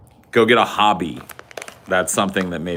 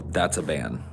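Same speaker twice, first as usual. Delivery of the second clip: usual speaking voice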